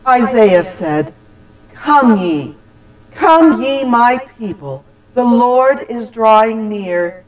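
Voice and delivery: usual speaking voice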